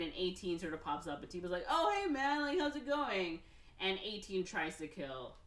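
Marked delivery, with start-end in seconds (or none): 1.7-3.4 casual greeting. Retold or imitated. Timo casually greets the clone.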